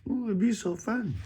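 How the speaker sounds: very high, put-on squeal of delight; mock excitement. Teacher teasing students about a quiz, mock excitement. Voices eager reply to quiz.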